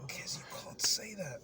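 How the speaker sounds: whispered, hushed protest; unvoiced whispered scolding of a friend; hushed warning. Real, not acted. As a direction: hiss it so only he hears. Scolding friend's rude joke.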